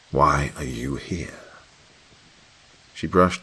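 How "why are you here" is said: slow, frightened whispered question; shaken whisper. A narrator's reading. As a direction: force the words out through fear. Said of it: "Shaken, I was able to force out another".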